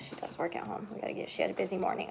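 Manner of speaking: quick whispered aside; whispering. Real, not acted. Aside to camera about mom.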